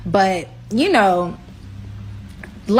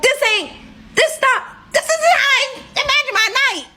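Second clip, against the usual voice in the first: much higher, indignant complaint; exasperated. Retold or imitated. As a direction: squeal your disbelief at how the night turned out. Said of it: "I'm still pissed off".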